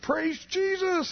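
high, drawn-out tearful praise; mock bawling. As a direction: imitate a man crying out with joy. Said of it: "he just started bawling and crying".

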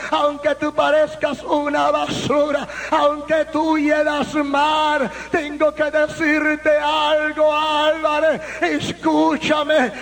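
forceful testimony sermon voice; impassioned preaching. Real, not acted. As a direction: preach the story with full intensity. (predicación apasionada a toda fuerza)

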